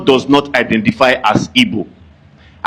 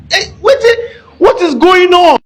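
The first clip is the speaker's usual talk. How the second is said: much higher, squeaky comic disbelief; 4 dB louder than usual. Real, not acted. Speaker mocking the whole argument, squeaky high disbelief. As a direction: squeal it like you can't believe it.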